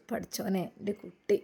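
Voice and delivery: put-on softly, grandfather cooing over the baby; tender murmur. Grandfather kissing his grandchild, tender soft murmur. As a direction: hush it lovingly, like kissing a grandchild.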